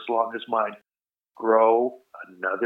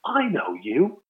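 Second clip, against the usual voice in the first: recognizing voice; 5 semitones higher, much slower than usual. Retold or imitated. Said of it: "I hear this voice going".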